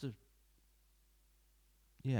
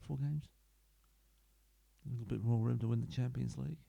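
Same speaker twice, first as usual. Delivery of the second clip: ordinary talk, nothing marked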